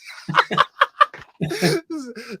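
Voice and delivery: big high-pitched burst of laughter. Real, not acted. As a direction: laugh out loud, amused. Laughs at DM story.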